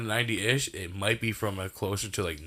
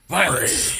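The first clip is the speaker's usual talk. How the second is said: dramatic shout; 8 semitones higher, 8 dB louder than usual. Retold or imitated. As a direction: shout Violence much higher and louder. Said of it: "I was supposed to go".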